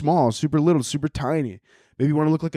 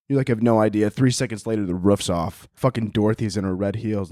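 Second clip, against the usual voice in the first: ordinary delivery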